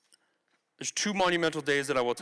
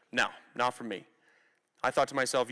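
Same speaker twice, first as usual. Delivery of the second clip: ordinary delivery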